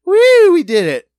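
loud, high, drawn-out whoop; big hammed-up celebratory holler; mock cheer. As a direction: imitate a crew cheering in triumph. Acts out workers celebrating too soon.

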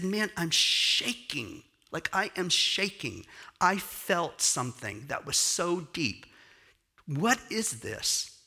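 high, slow, trembling wonder; young dancer shaken by what he felt, high and slow; overwhelmed awe. Retold or imitated. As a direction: voice lifted by something too big. Dancer overwhelmed, says he's shaking.

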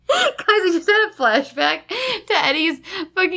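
helpless giggling while talking; words breaking up with laughter; laughing. Real, not acted. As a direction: try to explain through real laughter. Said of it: "Why is this making me laugh harder".

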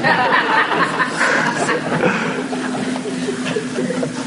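a soft, airy laugh with no voice; breathy laugh. Real, not acted. Preacher laughing softly at his own emoji joke. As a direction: let a quiet breathy laugh slip out.

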